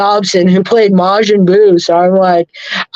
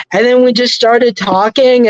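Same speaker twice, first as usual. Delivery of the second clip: ordinary talk, nothing marked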